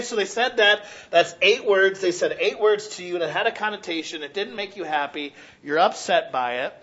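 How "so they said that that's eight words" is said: ordinary talk, nothing marked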